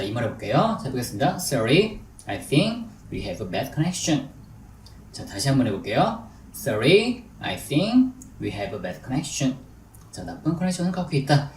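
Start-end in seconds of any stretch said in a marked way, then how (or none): 1.4-4.3 slow, with pauses between chunks; deliberate, phrase-by-phrase English demonstration; slow drill model. Shown as a demonstration. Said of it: "천천히 해볼게요". 6.5-9.6 slow, with pauses between chunks; deliberate, phrase-by-phrase English demonstration; slow drill model. Shown as a demonstration. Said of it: "천천히 해볼게요".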